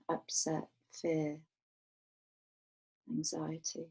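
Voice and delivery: calm, unhurried meditation voice with long pauses; guided meditation. Real, not acted. Teacher guiding a group to rest awareness on the breath, guided meditation. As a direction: guide them gently, letting each phrase settle and fall away.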